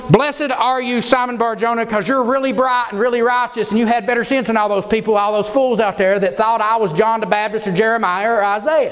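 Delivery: high, gushing misquote in one run; mock praise. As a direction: pile on the praise, mock-congratulatory. Mock misquote of Jesus, then "No.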